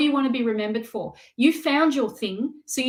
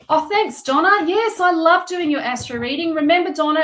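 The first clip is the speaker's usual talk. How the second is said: higher, louder, delighted thanks to Donna; light up reading her comment; excited thanks; 6 semitones higher, 7 dB louder than usual. Real, not acted. Thanking viewer Donna in chat.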